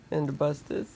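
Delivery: ordinary delivery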